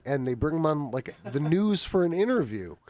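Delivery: usual speaking voice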